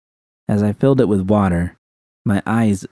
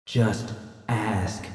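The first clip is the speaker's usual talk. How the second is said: hushed inner urge; 9 dB quieter than usual. A narrator's reading. Her own mind urges Judy to ask V, hushed. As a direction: push her gently to speak.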